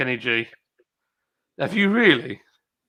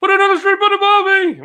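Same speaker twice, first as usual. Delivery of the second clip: high, loud hammed-up Aussie shout; mock Australian accent; 9 dB louder than usual. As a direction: belt out a terrible fake Australian accent. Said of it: "Oh, I can speak Australian".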